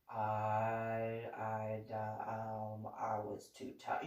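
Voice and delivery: caught stammer. Retold or imitated. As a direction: shrink into a guilty mumble.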